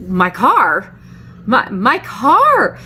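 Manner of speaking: drawn-out, rising shocked question; retold disbelief. Retelling shock at stripped car.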